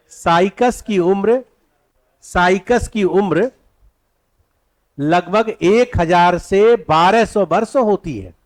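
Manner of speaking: slow, measured dictation with repeats. Shown as a demonstration. Teacher dictating the lifespan of Cycas, slow dictation. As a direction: dictate phrase by phrase, leaving gaps. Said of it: "तो लिखिएगा".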